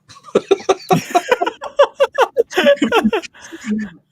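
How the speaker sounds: long high burst of real laughter; big laugh. Real, not acted. Laughing hard at the newspaper story. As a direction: cracks up at his own tale.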